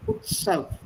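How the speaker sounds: crisp ts in "Utsav"; Sanskrit utsav. Shown as a demonstration. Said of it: "from Sanskrit".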